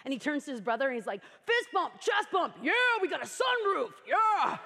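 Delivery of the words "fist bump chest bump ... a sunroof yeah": higher hyped-up meathead shout; Jersey bro whooping at the sunroof; emotional meathead. Retold or imitated. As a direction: pump up your brother like a jock. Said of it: "emotional meathead".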